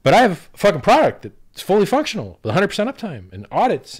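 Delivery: loud, high, fired-up protest; furious rant. Real, not acted. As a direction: hammer every claim home in real anger. Furious rant about his product.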